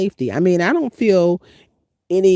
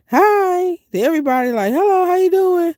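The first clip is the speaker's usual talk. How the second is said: chirpy greeting; 12 semitones higher, 3 dB louder than usual. Retold or imitated. Acting out her friendly greetings.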